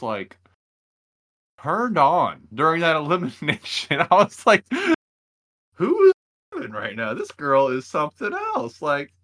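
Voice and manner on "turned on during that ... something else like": high, loud and gushing with real excitement; excited rave. Real, not acted. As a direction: burst out like a brand-new fan. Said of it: "I was impressed".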